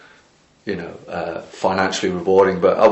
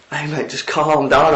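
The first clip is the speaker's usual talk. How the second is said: higher, slow soothing plea; retelling how he calmed a furious man; calming; 8 semitones higher than usual. Retold or imitated. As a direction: ease him down slowly, pitched up.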